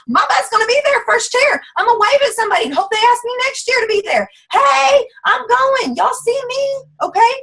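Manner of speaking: hyped-up declaration. Acted. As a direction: announce it like you can't wait to go. Acting out her eager reply.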